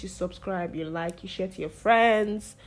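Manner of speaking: ordinary talk, nothing marked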